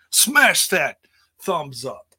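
rally the viewers to smash it; loud hype shout-out; hype call. Acted. Calling viewers to like.

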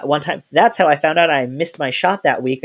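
ordinary delivery

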